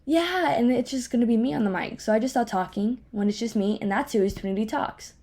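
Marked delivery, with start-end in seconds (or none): none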